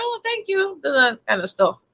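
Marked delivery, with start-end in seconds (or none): 0.0-0.8 breathy-free high thin "thanks"; a small, much higher and much softer polite reply; dismissive high "Oh, thanks"; high, soft mock thanks.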